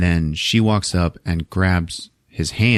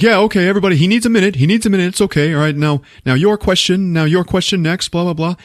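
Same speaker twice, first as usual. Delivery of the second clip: high, raised crowd-control voice; press handler; 10 semitones higher, 3 dB louder, faster than usual. Retold or imitated. Man facilitating the crowd's questions, imitated press handler.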